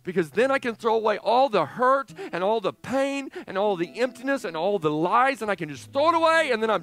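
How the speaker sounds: high, loud, building to a shout; fervent, full-force outburst; impassioned preaching. Real, not acted. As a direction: hammer out each thing being thrown away. Preacher vows to discard the hurt.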